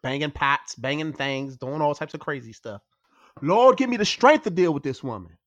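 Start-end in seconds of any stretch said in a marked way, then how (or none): 3.4-5.4 exasperated prayer for patience; loud, fed-up plea to the Lord; praying for patience through teeth. Acted. Exasperated prayer at noisy cohost.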